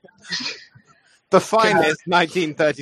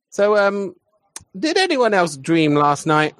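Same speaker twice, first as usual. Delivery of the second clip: usual speaking voice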